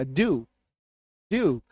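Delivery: ड्यू said softly with just a hint of y; slight y-glide. Shown as a demonstration. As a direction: quietly model ड्यू, barely touching the y. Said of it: "यो का हल्का सा झलक आएगा". (धीमे से ड्यू, 'य' की हल्की झलक)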